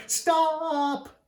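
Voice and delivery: high, loud cry after the runaway tractor; calling out. Acted. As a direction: yell it while chasing the tractor. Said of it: "and he's like".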